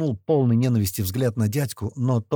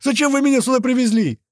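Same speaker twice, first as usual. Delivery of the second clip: much higher, loud frightened voice; panicked pleading; 13 semitones higher, 6 dB louder than usual. A narrator's reading. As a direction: plead in terror with your captors. Перепуганный пленник оправдывается. (испуганная мольба, высоко и громко)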